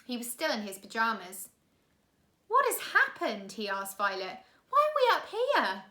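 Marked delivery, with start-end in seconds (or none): 2.5-3.5 put-on higher, louder boy's voice; startled boy. Klaus waking confused beside his sister, higher and alarmed. 4.7-5.8 put-on higher, louder boy's voice; startled boy. Klaus waking confused beside his sister, higher and alarmed.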